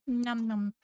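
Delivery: usual speaking voice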